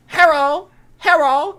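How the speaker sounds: high, shouted "Hero, hero"; loud call. Acted. Soldier calling out to the enemy GI, shouted loudly. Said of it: "He says it real loud".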